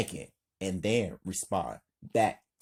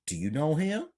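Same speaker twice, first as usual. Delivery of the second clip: higher, rising curious question; mildly inquisitive, pitched up; 7 semitones higher, faster than usual. A narrator's reading. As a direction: ask it curiously, lifting at the end. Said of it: "Nina, curious".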